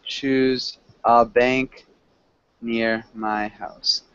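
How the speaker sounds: slow, careful model sentence; slow example sentence. Shown as a demonstration. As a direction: spell out the example slowly. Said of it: "you could say".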